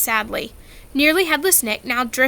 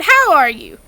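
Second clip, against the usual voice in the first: hearty huntsman; 4 semitones higher, 6 dB louder than usual. A narrator's reading. Hearty hunting ghost.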